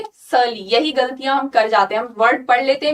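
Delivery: usual speaking voice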